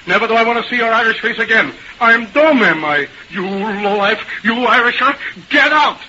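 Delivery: acted angry outburst, building to the last order; indignant shouting with punched commands; wrathful shout. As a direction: throw them out in a rage. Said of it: "had risen in his wrath and shouted".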